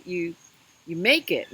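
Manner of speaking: usual speaking voice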